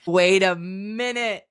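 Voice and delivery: slow, loud retold disbelief; dawning realization. Recalling when a dirty joke made sense, slow astonished realization. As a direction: stretch it out as the penny drops.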